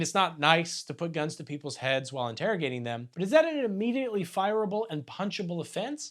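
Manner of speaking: normal talking voice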